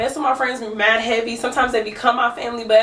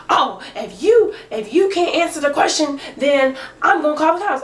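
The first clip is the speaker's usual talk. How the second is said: pitched-up mimicry of a huffy woman; mock threat; 5 semitones higher than usual. Neighbor threatening to call the cops, huffy mimicry. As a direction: threaten the cops, mocking her.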